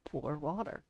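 soft, low parrot mimicry; imitating a parrot. Streamer echoing the parrot clip, soft low imitation. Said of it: "the parrot says".

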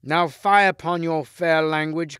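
king rejecting Lancelot's plea in anger; angry cry. A narrator's reading. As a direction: scorn his fine words. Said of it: "cried the king".

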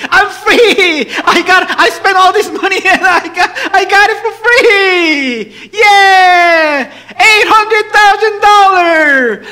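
high, hammed-up cries of joy; exuberant shouting. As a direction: yell in celebration, overjoyed at being debt-free. Acting out joy at cancelled debt.

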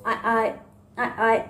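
lightly voiced sailor calling aye aye; sailor's aye aye. Shown as a demonstration. Teacher acting a sailor replying aye aye. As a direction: answer like a deckhand on a ship. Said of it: "जोलीफोनिक में जो action है".

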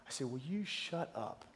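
muttered under the breath, inner exasperation; an exhausted dad's silent grumble. Retold or imitated. To his screaming kids, a quiet half-laughing grumble. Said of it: "in my heart, I say".

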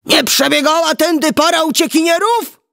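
high, urgent shouted question; giant's shout. A narrator's reading. Giant questioning a sexton about runaways, shouting. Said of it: "krzyknął do kościelnego".

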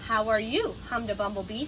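ordinary delivery, nothing marked

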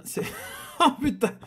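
laughs then swears in dismay; a short laugh of disbelief at bad luck; laughing curse at the missing N; rueful laugh. Real, not acted.

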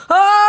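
sudden high yelp of fright; startled yelp. Real, not acted. Gamer startled by a plane crash, high yelp.

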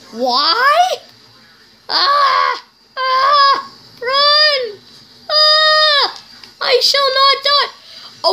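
high screaming panic; panicked screaming. Real, not acted. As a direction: scream and flee in terror. Panicked flight in game.

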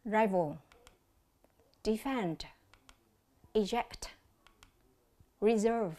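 Rival stressed first, defend, eject, reserve stressed second; word stress demo. Shown as a demonstration. Teacher shows students which stress differs, careful and separated. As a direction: say each word alone, stressed syllable clear. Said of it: "Quan sát lại cách đọc các em nhé".

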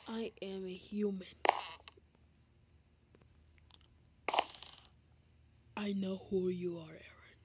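low whispered character voice as Garth. Pretend. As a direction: whisper the line like a shady Garth. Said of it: "that was not good at all".

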